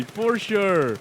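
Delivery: normal talking voice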